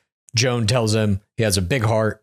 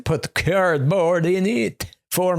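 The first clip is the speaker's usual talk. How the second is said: put-on slow, hammy French accent, higher; 5 semitones higher, much slower than usual. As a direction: fake French accent like Borat. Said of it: "Is that close to a French accent?".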